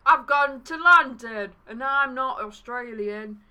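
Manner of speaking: slow, hammed-up Australian drawl; Aussie accent. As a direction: put on a badly faked Aussie accent. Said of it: "should have done that in an Aussie accent".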